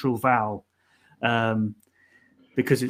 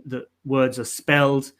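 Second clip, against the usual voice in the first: usual speaking voice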